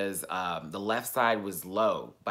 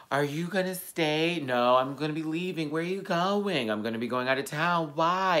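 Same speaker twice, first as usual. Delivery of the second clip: lightly voiced small boy, higher; little brother; 7 semitones higher, 3 dB louder than usual. Little brother asking why he is leaving, childlike and higher. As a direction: ask like a curious little kid.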